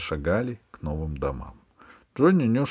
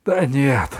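higher, louder dismay; a pang of dismay; suddenly upset; 6 semitones higher, 7 dB louder than usual. A narrator's reading. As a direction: object with sudden disappointment. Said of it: "вдруг огорчился Джонни".

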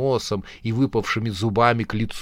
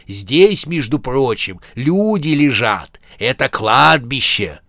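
louder scolding corpse; irritated corpse; 3 semitones higher, 6 dB louder than usual. Acted. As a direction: scold the noisy visitors. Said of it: "раздражённо прошипел мертвец".

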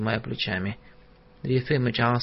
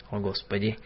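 ordinary delivery

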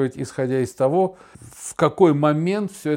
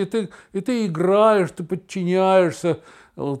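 normal talking voice

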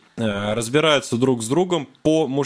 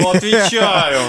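russian street thug; 5 semitones higher, 6 dB louder, slower than usual. Pretend. Mock street-talk oath banter.

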